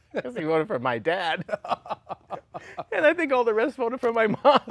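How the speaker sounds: words broken up by real laughter; laughing. Real, not acted. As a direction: laugh through the punchline. Laughing at his own punchline.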